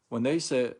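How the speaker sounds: usual speaking voice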